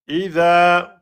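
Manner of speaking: "Idha" chanted with a long final a; two-beat prolongation. Shown as a demonstration. Teacher demonstrating the beats, "Idha" drawn out. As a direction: stretch the end of "Idha". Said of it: "prolongation de temps".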